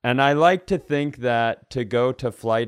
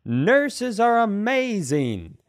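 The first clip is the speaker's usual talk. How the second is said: gushing mock praise; 9 semitones higher, much slower than usual. Mocking people who lump nurses.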